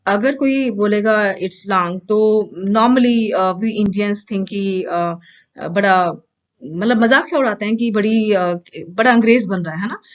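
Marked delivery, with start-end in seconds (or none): none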